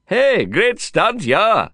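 high, loud cheer with drawn-out words; calling out. A narrator's reading. Stuntman hailing a fallen rider, calling out high and loud. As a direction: call it out slowly across to a rider. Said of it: "one of the stunt men called out".

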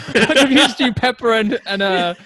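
laughing high and loud through the words; laughing at confusing pepper and pear. Real, not acted. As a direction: giggle it out at your own slip. Laughing at his own mix-up.

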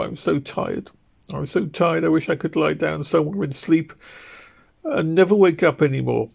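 tearful wife. Acted. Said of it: "Ruth sobbed".

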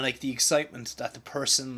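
ordinary delivery

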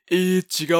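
loud mock scolding correction; butting-in correction. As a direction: imitate a know-it-all cutting in.

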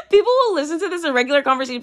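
high, rushed words through laughter; laughing while talking. Real, not acted. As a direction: talk fast through a fit of giggles. Cracks up at her own antics.

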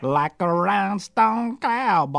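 Southern American accent. Shown as a demonstration. Guest auditioning accents for the hosts, deep Southern American accent. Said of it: "deep Southern American".

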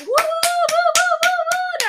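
very high, loud whooping cheers; loud, high celebration woos; crowd cheering. Retold or imitated. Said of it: "they start applauding".

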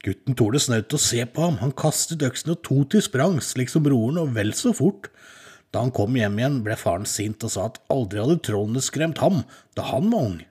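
ordinary delivery, nothing marked

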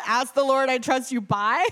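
laughing, baffled quote-back; incredulous echo. Retold or imitated. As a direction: parrot it back in disbelief. Repeats the answer in disbelief.

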